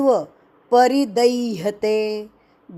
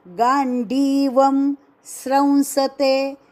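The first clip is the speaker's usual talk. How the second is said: ordinary talk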